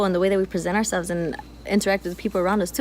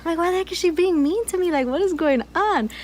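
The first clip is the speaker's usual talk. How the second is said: higher, puzzled inner voice; inner thoughts voiced; 8 semitones higher than usual. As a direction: play your own baffled thoughts. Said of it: "in my head, I'm like".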